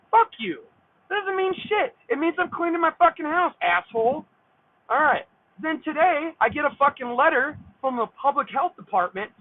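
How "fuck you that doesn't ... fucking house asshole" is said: indignant rant, pitched high; angry retort. Real, not acted. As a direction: throw it back at the neighbor, heated. Ranting back at the absent neighbor.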